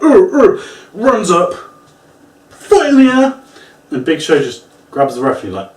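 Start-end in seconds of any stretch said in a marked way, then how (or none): 0.0-0.6 straining grunt. Retold or imitated. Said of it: "He's like".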